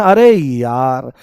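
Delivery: "अरे यार" drawn out slowly to show the य; slow sample phrase. Shown as a demonstration. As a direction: stretch "अरे यार" so the ya glide stands out. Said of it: "यार बोलते ना".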